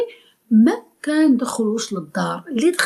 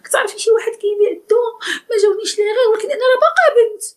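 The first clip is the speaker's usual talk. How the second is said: much higher, tearful mock girl's plea; mock crying girl; 10 semitones higher, faster than usual. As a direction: fake sobbing, pitched way up, rushing at the end. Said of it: "ما تجيش تقولي".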